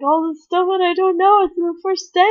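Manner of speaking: excited gush. Retold or imitated. Excited quote, high.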